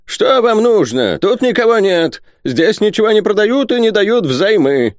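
high, loud, hostile character voice; shrill them away from your goods; hostile old miser. A narrator's reading. Isaac refusing visitors any sales or loans, hostile.

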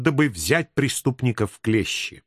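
usual speaking voice

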